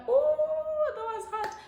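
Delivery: high, loud drawn-out squeal; gushing, high-pitched fangirl reaction; excited swoon. Retold or imitated. Retold swooning reaction to Kyle.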